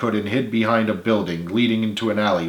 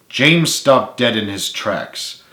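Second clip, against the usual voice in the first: usual speaking voice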